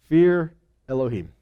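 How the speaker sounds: normal talking voice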